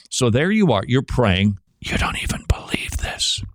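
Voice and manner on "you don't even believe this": unvoiced doubting whisper in the head; insidious whisper, planting doubt; whispered intrusive thought. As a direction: whisper it like a nagging inner doubt. Intrusive thought whispering during prayer.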